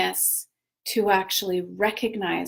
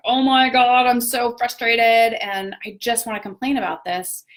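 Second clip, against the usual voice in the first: louder, higher burst of mock frustration; mock frustrated outburst; 3 semitones higher, 5 dB louder than usual. Venting to a friend, a hammed-up frustrated outburst. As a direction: vent it like you're fed up. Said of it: "And I'm like".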